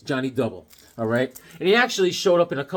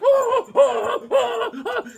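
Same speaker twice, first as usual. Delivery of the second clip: high mock whimpering; panicked whimper; much slower than usual. Kid fleeing his mom around the house, high whimpers. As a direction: whine like a kid being chased. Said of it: "I was like".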